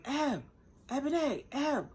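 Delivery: high-pitched call to get attention; imitated calling out. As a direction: call a friend's name down the street, lightly acted. Someone calls her in the street.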